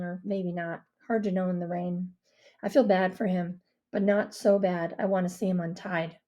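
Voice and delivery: ordinary delivery, nothing marked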